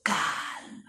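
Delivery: whispered calm; soft calm. Shown as a demonstration. Preacher repeating calm softly, whispered. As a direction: breathe the word calm. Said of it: "Repeat that word".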